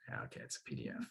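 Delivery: barely audible aside to himself; muttering to himself. Real, not acted. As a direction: mumble it under your breath while busy. Muttering to himself while opening file.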